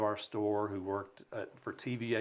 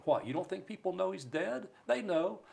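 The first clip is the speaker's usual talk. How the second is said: mocking scold; 9 semitones higher than usual. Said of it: "make fun of me or chew me out".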